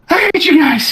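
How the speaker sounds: high, breathless whiny complaint; out-of-breath goblin whine. Acted. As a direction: gasp it out, fed up and high. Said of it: "out of breath Baril's like".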